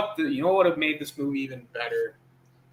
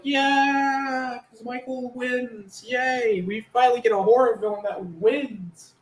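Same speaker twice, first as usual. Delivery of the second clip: high-pitched drawn-out cheer; hammed-up glee at a win; happy cheering; 8 semitones higher, slower than usual. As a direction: celebrate in a high, stretched voice. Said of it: "I'm so happy".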